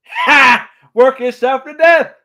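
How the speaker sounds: explode, throwing the words back; mock furious echo. Hades mocking Zeus's advice, loud high shout. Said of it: "so hilarious and so quotable".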